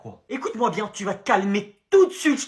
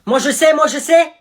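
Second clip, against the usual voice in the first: high, loud eager calling out; keen pupil desperate to answer; eager shout; 7 semitones higher, 11 dB louder than usual. Acted. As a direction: wave hand and call out keen.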